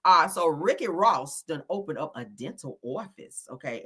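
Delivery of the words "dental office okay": "office" with a New York aw; New York accent. Acted. Host announcing a dental office, mock New York accent. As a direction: give "office" a rounded New York vowel. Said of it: "in my New York accent".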